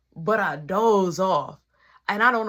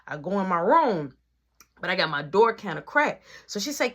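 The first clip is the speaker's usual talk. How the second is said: ordinary talk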